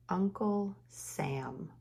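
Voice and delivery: "Uncle Sam" drawn out slowly with stress on Uncle; slow clear naming. Shown as a demonstration. Teacher slowly names the picture for the kids. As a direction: stretch out "Uncle" for the class. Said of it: "Uncle starts with a".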